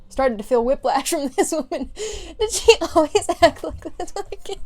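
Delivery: high, loud words breaking into laughter; laughing while reading. Real, not acted. Reader cracking up at the mother's mood swing, laughing. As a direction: try to read on through real giggles.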